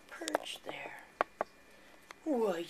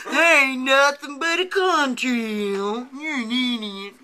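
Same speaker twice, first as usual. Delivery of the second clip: mocking voice; 3 semitones higher, 11 dB louder, slower than usual. Mocking a skeptic commenter.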